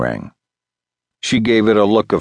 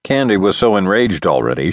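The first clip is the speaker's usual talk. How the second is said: ordinary delivery, nothing marked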